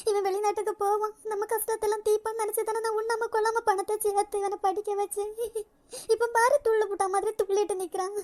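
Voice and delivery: weeping despair. Acted. Mother weeping to daughter-in-law about wedding debts, soft and tearful. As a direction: sob out her worries.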